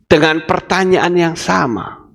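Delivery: ordinary talk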